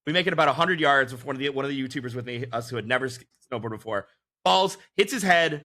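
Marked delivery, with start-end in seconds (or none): none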